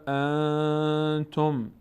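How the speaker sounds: انتم very slow with the n held nasally; drawn-out recitation of انتم. Shown as a demonstration. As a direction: stretch the ن in انتم through the nose.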